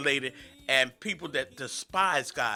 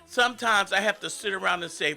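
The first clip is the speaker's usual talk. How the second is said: usual speaking voice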